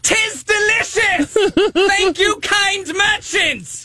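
high, slow booming herald, laughing; town crier voice. Acted. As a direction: proclaim your thanks like a crier. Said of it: "in the town crier voice".

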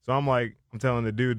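usual speaking voice

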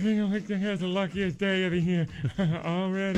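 giggling through a copied line; laughing mimicry. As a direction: laugh while parroting the quote. Mimicking clip while laughing.

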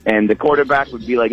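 ordinary talk

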